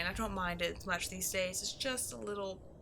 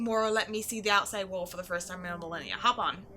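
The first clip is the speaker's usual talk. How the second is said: ordinary talk, nothing marked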